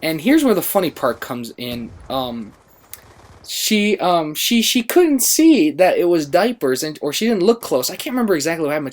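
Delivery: ordinary talk, nothing marked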